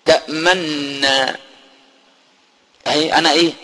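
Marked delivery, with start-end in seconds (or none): 0.0-1.4 slow تأمنا, lips silently rounded on the nun; ishmam demo. Shown as a demonstration. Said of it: "ضمن بق أهو".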